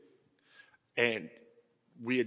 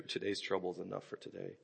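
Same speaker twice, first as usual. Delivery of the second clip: much softer, lower echo of the verse; soft repeat; 8 semitones lower, 14 dB quieter than usual. Real, not acted. Preacher repeating the verse to the congregation, hushed. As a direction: let it sink in gently.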